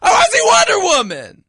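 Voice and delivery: very high, outraged shouting; hammed-up yelling. Shut-out moviegoer protesting to co-host, indignant yelling. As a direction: wail it like a mock tantrum. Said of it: "Why are you yelling?".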